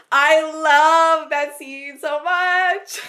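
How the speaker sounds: drawn-out, high, loud fan squeal of delight; gushing. Real, not acted. Podcaster raves about a favourite scene, gushing.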